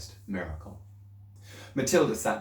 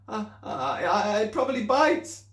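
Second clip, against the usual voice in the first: higher, halting, nervous; frightened stammer; 12 semitones higher, 4 dB louder than usual. Acted. Girl stammering fearfully about the newt. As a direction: stammer out a fear.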